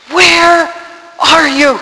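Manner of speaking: high, loud, desperate call to God; retold crying out. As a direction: shout it up to heaven, word by word. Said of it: "I cried out to God".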